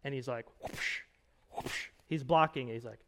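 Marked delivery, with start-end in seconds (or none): 0.6-1.0 soft, breath-only whoosh of a punch; imitated whoosh. Preacher acting out karate punches and blocks, whooshing sound effect. 1.5-1.9 soft, breath-only whoosh of a punch; imitated whoosh. Preacher acting out karate punches and blocks, whooshing sound effect.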